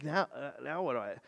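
fast, soft befuddled rambling; confused king. Retold or imitated. Befuddled king asking Elisha what to do, quick and soft. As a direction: stumble like you're lost and clueless. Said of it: "this confused, befuddled look".

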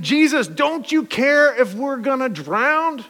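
high, slow desperate plea; pleading disciples. Retold or imitated. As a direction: beg Jesus in the storm.

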